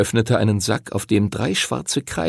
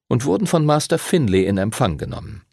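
ordinary delivery

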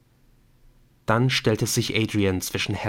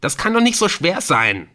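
exasperated shout; 8 semitones higher, 5 dB louder than usual. A narrator's reading. Panicked hostage keeps shouting.

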